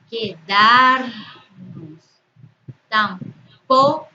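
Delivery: "mañana", "tampoco", "fácil" slowly, next-to-last syllable loud and long; stress on penultimate syllable. Shown as a demonstration. Teacher giving examples of graves, penultimate syllable stressed hard. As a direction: break each word up and punch its penultimate syllable. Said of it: "en la penúltima sílaba".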